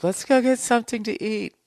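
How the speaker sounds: high, loud mock girlfriend voice; squeaky imitation of the girlfriend asking. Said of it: "my girl's like".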